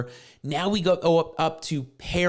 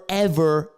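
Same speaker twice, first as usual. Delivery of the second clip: ever pushed out louder, a firm repeat; stress on ever; 3 dB louder than usual. Shown as a demonstration. Explaining intestate heirs, ever said with stress. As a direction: punch ever once more, firmly. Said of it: "And I stress that word ever".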